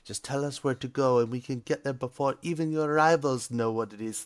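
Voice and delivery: put-on eager apprentices in a cheesy Italian accent. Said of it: "a cheesy, um, Italian accent".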